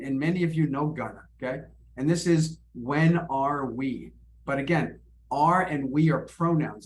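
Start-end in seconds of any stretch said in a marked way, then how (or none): 2.7-4.1 "When are we" very slow, each word separate; full careful form. Shown as a demonstration. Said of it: "I'm reducing this".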